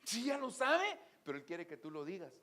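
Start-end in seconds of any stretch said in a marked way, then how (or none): 0.0-1.0 high, loud burst; loud exclamation. Real, not acted. Preacher crying out to the congregation, loud. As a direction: call it out to the whole church.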